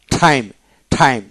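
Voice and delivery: "টাইম" with its T close to ঠ; aspirated T. Shown as a demonstration. Teacher repeating time for learners, the T heavily aspirated. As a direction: puff out the opening T of "টাইম". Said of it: "অনেকটা ঠ".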